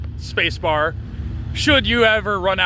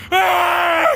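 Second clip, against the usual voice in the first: sudden scream; 12 semitones higher, 5 dB louder than usual. Retold or imitated. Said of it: "he's like".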